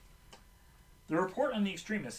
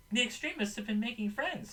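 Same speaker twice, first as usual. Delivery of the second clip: crying out; 7 semitones higher than usual. A narrator's reading. Tara calling out mid-firefight, high-pitched cry. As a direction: call it out high without shouting. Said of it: "Tara cries".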